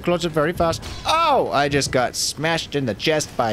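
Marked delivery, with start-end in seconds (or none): 1.1-1.5 surprised yelp. Real, not acted. Hit by a warhammer.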